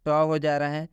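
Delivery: ordinary talk, nothing marked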